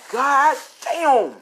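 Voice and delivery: loud, high, drawn-out whoop of amazement; big excited outburst; amazed exclamation. Real, not acted. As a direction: marvel loudly at the blow. Teller amazed at the punch.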